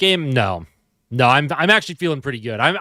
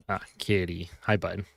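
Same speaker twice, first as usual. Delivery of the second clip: soft cat greeting; 7 semitones lower, 10 dB quieter than usual. Real, not acted. Greeting cat on desk softly.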